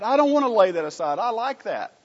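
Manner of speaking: stubborn clinging. Retold or imitated. Preacher mimics a believer refusing to let go, childish. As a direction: play a believer clinging to their sin.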